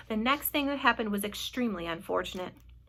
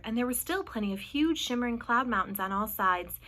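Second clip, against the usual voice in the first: usual speaking voice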